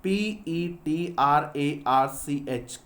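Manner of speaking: letters spelled slowly; slow letter-by-letter dictation; slow spelling out. Shown as a demonstration. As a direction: spell the name out for notes. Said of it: "sir Petrarch".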